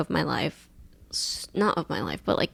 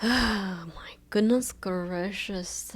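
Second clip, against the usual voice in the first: long, slow sighed-out exclamation; exasperated sigh; 3 dB quieter, much slower than usual. Real, not acted. Storyteller winding up her crazy day, exasperated sigh. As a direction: let it out on a heavy sigh. Sighs after recounting a bad day.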